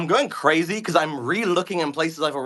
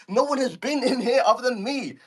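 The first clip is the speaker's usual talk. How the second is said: usual speaking voice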